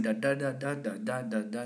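even run of DA, DA, DA in a rolling beat; wave-like rhythm. Shown as a demonstration. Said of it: "the pacing of it's like the wave".

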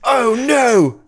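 high, dismayed yelp; alarmed cry. Acted. Guard seeing his spell fail, alarmed cry. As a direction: cry out high in mock horror.

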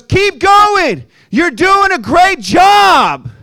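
loud, high, slow cheerleading yell; cheery shouted encouragement. Retold or imitated. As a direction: bellow it like an overeager coach. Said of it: "and he goes".

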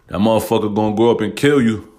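usual speaking voice

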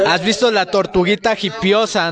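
normal talking voice

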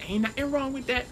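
high mock voice; lightly voiced sassy woman; imagined women's voice. Imagining women listeners answer, in a light mock voice. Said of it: "that's how I imagine they say it".

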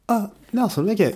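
put-on bright, high pitched hello to an old friend; retold, pleased surprise at running into him; surprised greeting.

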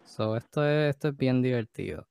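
ordinary talk, nothing marked

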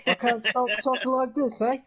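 high-pitched fake British voice; mock British accent. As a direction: imitate someone stuck in a British accent. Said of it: "like that one chick that, like, bonked her melon".

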